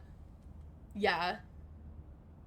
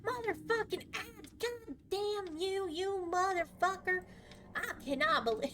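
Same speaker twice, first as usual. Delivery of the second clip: high, hammed-up mock rage; mock angry gamer; 9 semitones higher, 3 dB quieter than usual. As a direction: imitate chat cursing at the ads. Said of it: "in Roblox chat is like".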